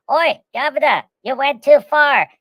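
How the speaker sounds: loud, high Cockney "Oi, Governor" sat-nav; Cockney GPS. Retold or imitated. British GPS scolding the driver, loud Cockney. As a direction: bark directions like a London cabbie. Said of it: "it says".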